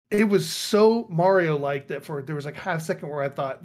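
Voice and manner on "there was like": normal talking voice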